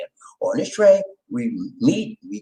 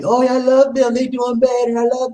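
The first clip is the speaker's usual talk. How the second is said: mock blind supporter; 9 semitones higher, 4 dB louder than usual. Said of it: "you think I'm gonna sit there".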